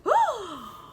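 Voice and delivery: high, sharp gasp of shock; dramatic gasp. Acted. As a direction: gasp as if the wolf just pounced. Story climax gasp.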